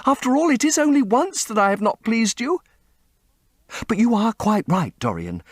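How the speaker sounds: put-on high, desperate young woman's plea; pleading young woman. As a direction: beg him not to leave. Sibyl pleading continues.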